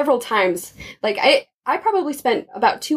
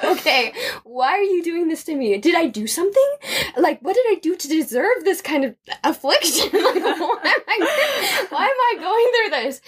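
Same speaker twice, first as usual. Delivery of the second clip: mock angry complaint to God; 7 semitones higher than usual. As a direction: pitch climbing higher, laughing through a mock tantrum. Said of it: "I was so mad".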